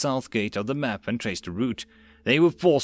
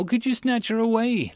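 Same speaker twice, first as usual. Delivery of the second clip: sharp, higher rising retort; snapped; 11 semitones higher than usual. A narrator's reading. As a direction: bite back at the rival. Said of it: "Lord Devlin snapped".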